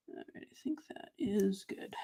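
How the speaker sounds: very soft, near whisper; hushed concentration. Real, not acted. Crafter carefully lining up the panel while everyone holds breath, hushed concentration. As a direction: barely voice it, as if holding your breath.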